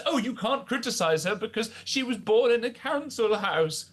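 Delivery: high, sneering mimicry of her defenders; mock the excuse in a whiny, lifted voice; mocking quote. Host mocking her defenders, in a sneering high voice. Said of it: "the idea of saying".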